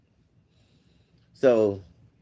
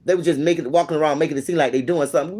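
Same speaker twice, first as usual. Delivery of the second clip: usual speaking voice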